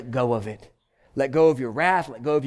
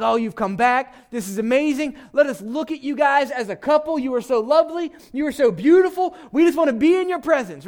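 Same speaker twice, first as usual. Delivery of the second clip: high, gushing excitement, climbing higher as it goes; excited; 11 semitones higher, 4 dB louder than usual. Retold or imitated. Daughters of Jerusalem greeting the returned couple, excited. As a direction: gush like thrilled onlookers, pitched way up. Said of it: "And they're so excited".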